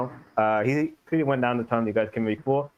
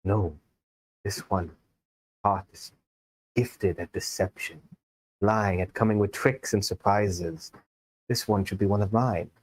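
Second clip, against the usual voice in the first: clouded smoky voice; 3 semitones lower than usual. Acted. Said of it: "a voice more clouded, like it's coming from smoke".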